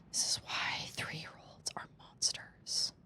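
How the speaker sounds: whispered aside. Real, not acted. Mom whispering a complaint about her three-year-old.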